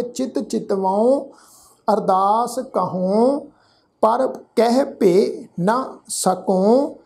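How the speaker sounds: ਚਿਤਵਾਉ, ਕਹਉ, ਤਕਉ ending with a nasal tone, slowly. Shown as a demonstration. Teacher demonstrates nasal endings in slow recitation. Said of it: "ਨਾਸਕੀ tone ਚ ਪਾਠ ਉਚਾਰਨ ਕਰਾਂਗੇ".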